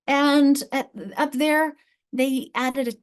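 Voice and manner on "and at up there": ordinary talk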